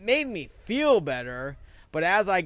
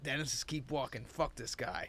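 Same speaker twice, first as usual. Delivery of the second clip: inner voice; 3 semitones lower, 13 dB quieter than usual. Retold or imitated. Son's inner thought while walking.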